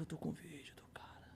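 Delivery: soft unvoiced whisper; hushed aside about the video. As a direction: murmur it like a secret. Secretly has the video.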